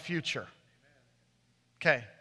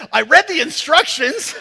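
laughing defensively; 9 semitones higher, 10 dB louder than usual. Retold or imitated. Confronting angry neighbor, laughing.

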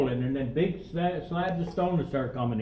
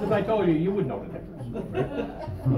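usual speaking voice

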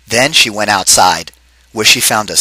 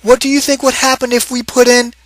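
high character voice; 13 semitones higher than usual. Acted. Husband asks the room about the pot, in a high character voice. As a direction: lift into a high, bright-toned figure voice. Character voice in read-aloud story.

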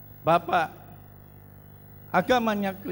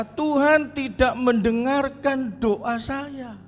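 complaining; 6 semitones higher, slower than usual. Retold or imitated. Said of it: "Seringkali kita berkata".

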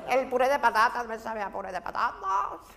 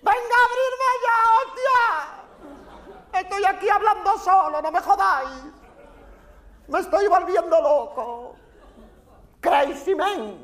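exasperated shouting, high and loud; fed-up bellowing of someone going stir-crazy; angry yelling; 7 semitones higher, 7 dB louder than usual. Acted. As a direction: howl at the shutters to be let out. Locked-in spectator yelling again.

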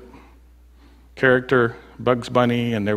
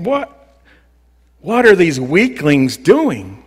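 mocking sneer, pitched up; 3 semitones higher, 5 dB louder, slower than usual. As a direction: ridicule the builders' hopeless work. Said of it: "He's mocking them".

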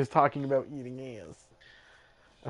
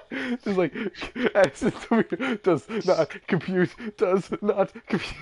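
glitching robot; 8 semitones higher than usual. Pretend. Robot voice mocking confused friend.

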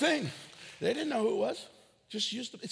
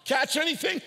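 mock passerby call; 8 semitones higher, 5 dB louder than usual. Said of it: "Just letting you know".

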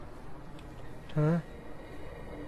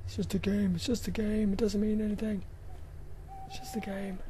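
self-reassurance; 4 semitones higher, 7 dB quieter than usual. Real, not acted. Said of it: "self-soothing".

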